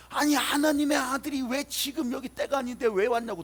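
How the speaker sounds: loud, high-pitched clamour; demons' frantic protest. Acted. Demons confronting Jesus as he arrives, acted frantic outcry. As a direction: throw out the protest like a demon in uproar. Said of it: "또 난리를 치고 있고".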